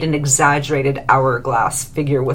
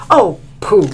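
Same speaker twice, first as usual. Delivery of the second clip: louder, scoffing oh pooh; dismissive snort; 2 semitones higher, 7 dB louder than usual. A narrator's reading. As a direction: brush it off with a snort. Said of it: "snorted Corset".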